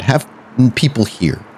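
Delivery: normal talking voice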